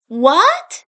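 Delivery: sharp high startled "What?"; Mikey startled by Raph's confession, yelping; surprised yelp. Acted. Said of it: "Mikey yelped in surprise".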